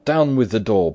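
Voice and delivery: loud, high, urgent command; shouted order. A narrator's reading. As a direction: order the door broken down at once. Urgent order to break the door.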